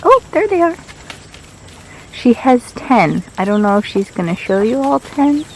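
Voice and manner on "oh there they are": high delighted exclamation; light up as the chicks appear; excited. Real, not acted.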